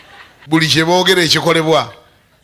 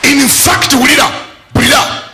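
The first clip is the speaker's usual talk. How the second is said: exhorting, raised voice; preaching call; 8 semitones higher than usual. Acted. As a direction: rouse the listeners.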